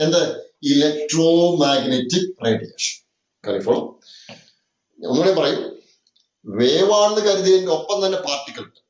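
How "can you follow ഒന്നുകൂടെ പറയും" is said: ordinary talk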